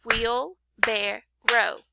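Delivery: "Wheelbarrow" slowly split into wheel, bar, row; three separate beats on "Wheelbarrow"; clapped-out syllables. Shown as a demonstration. Teacher clapping for pupils, "Wheelbarrow" in three spaced beats. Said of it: "if we clap it out".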